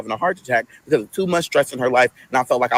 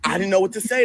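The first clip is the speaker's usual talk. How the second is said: ordinary delivery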